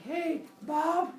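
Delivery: lightly acted high-pitched hail; playful calling out. Cyclops calls brother before stabbing.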